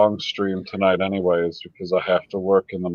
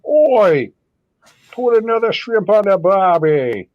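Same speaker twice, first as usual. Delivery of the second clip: high, loud, hammed-up Aussie yell; terrible Australian accent; 10 semitones higher, 5 dB louder than usual. Mocking the accent attempt, in a loud Australian accent. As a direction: shout it in a bad put-on Australian accent. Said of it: "He can't do the accent even with the starter word".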